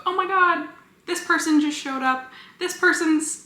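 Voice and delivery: high, squealing fangirl excitement; excited gushing. Retold or imitated. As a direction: gasp it out like you just spotted a favorite character. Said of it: "you've seen me talk about".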